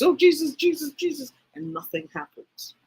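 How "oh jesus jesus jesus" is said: high, rapid-fire repeated invocation; mock fervent worshipper, pitched way up; imitated tongues-speaking. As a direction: babble it like someone praying in tongues. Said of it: "people speaking in tongues".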